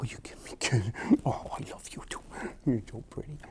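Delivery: soft whisper to a dog. Real, not acted. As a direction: coo it quietly.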